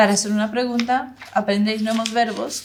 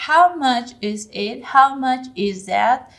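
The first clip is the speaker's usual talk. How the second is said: slow, louder model question; slow teaching model; 3 semitones higher, 5 dB louder, much slower than usual. Shown as a demonstration. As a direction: say it slowly and loudly for learners. Teacher models English questions for learners.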